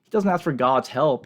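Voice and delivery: ordinary delivery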